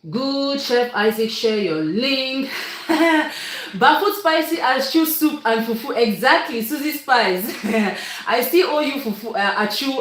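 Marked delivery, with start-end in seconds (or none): 2.5-3.7 a quick high laugh; delighted laugh at a comment; high bright laugh. Real, not acted. 8.3-9.3 words through a laugh, a bit fast; a fast, teasing laugh as you confess. Real, not acted. Teasing a viewer about food.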